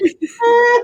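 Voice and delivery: high, silly sound effect; comic wordless squeal; imitated goofy noise. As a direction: act out a daft high-pitched noise. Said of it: "and I went like".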